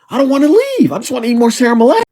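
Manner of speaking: very high-pitched comic plea; hammed-up pleading. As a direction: beg to stay, overdramatic. Comic refusal to leave Romania.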